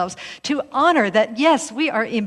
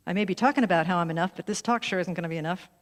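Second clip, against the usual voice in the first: rushed worry; 3 semitones lower, 3 dB quieter, faster than usual. Retold or imitated. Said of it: "And I'm like".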